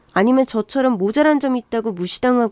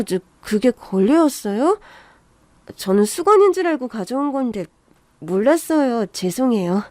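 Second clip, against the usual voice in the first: ordinary delivery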